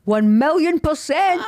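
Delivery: slow and loud, each word punched; emphatic. Real, not acted. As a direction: drag it out for emphasis. Emphatic answer about being single.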